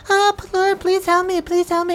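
mock his prayer in a high whiny voice; mocking villain. Villain mocking the cleric's prayer to Pelor, high whiny mock. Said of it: "Ruskak just mockingly goes".

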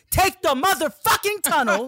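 high, loud angry order; talking at him. Retold or imitated. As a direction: shout the demand, re-enacting a blow-up. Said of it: "start talking at you".

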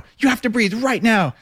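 imitating a frantic inner voice; mock urgent panic. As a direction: beg yourself to breathe right now. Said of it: "I was like".

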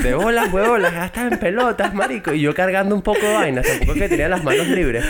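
high, laughing defensive rant; laughing protest. Real, not acted. Friend laughingly defends walking in on them.

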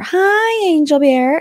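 high, drawn-out cutesy hello; excited pet-name greeting; gushing greeting. Retold or imitated. Acts out greeting her husband.

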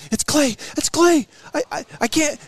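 hammed-up panic, can't hear on the phone; imitating panicked wife. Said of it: "she's freaking out on the other line".

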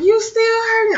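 high, sing-song concern; imitating sisters. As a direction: ask it in a mock sweet voice.

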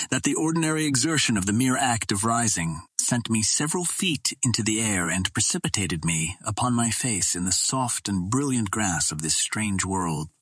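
plain reading voice

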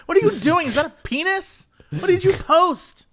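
high-pitched, incredulous telling-off; exaggerated outraged disbelief; mock scolding. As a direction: act out appalled questioning, way up high. Acting out scolding of chat.

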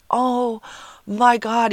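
high-pitched oh my God; a retold gasp of dismay; shocked. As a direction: let the shock show in his voice. His shocked reaction retold.

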